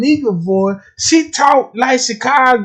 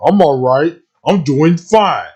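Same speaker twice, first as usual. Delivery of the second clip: mock programmed voice; 6 semitones lower than usual. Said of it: "like a goddamn programmer".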